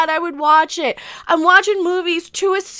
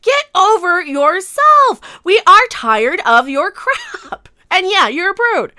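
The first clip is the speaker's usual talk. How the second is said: usual speaking voice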